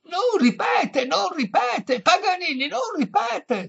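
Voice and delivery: very high, loud, slow shouts; shrieking. A narrator's reading. Exasperated virtuoso to deaf old lady, high loud shriek. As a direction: scream it over and over, exasperated. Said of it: "strillò il virtuoso".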